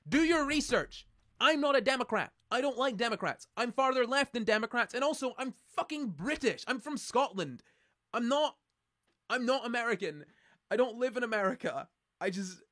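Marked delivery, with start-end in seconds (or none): 0.1-1.1 higher, louder, slow and emphatic "Do your research"; exasperated retort. Retold or imitated. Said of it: "I'm like". 1.4-11.9 louder, higher ranting reply; exasperated retort. Retold or imitated. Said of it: "I'm like".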